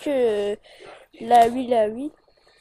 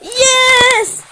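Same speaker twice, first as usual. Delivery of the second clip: loud, high victory shout; triumphant cheer; 11 semitones higher, 8 dB louder than usual. Real, not acted. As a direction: cheer the win. Survived the first night.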